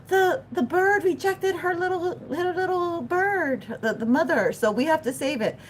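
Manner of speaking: high, far-off mock gasp of dismay. Telling viewers how humans react, high gasping dismay. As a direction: act out humans shocked by the bird.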